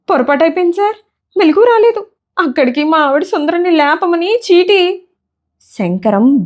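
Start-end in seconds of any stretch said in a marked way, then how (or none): 0.1-5.0 much higher, meek little voice of a scared clerk; timid pleading clerk. A narrator's reading. Frightened clerk Shankaram begging his angry boss, in a high meek voice. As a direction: whimper out excuses like a frightened underling.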